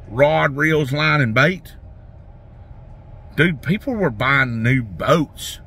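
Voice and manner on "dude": ordinary delivery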